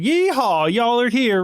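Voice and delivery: British waiter's attempt at a cowboy yeehaw; high-pitched yeehaw in a mock British accent. British waiter greeting diners in cowboy hat, mock British accent. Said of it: "but in a British a-, accent".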